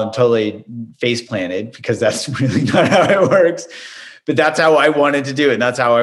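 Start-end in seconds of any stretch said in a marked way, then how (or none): none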